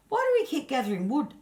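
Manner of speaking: high, fast, curious spirit voice; young spirit asking eagerly; Punjal's voice. Acted. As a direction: pitch up for the puzzled Punjal.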